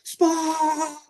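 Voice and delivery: slow, high scolding line; angry scold. Retold or imitated. Coach scolds the player who missed his spy, slow high scolding. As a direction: drag out every word in anger. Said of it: "top of my lungs".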